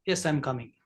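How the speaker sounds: low, soft yes; low tone reply. Shown as a demonstration. Teacher demos a calm low-toned reply. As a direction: drop the voice low and easy. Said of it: "It's a low, low tone".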